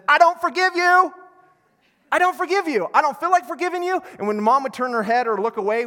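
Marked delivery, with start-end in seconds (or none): 0.1-4.0 put-on high, loud petulant child voice; whiny child. Child refusing to forgive his brother, high bratty whine. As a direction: whine it like a sulky kid refusing. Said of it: "the person said".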